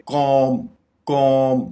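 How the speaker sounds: "कॉम" with long vowel, ending on m; silent b. Shown as a demonstration. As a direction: say "कॉम" as kohm, no b. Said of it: "तो हम ये बोलेंगे".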